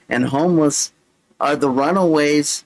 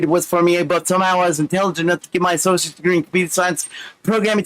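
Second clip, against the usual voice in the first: rapid-fire run-on reading; Micro Machine Man; faster than usual. Acted. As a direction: race through the script like a machine. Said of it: "read it like I'm the Micro Machine Man".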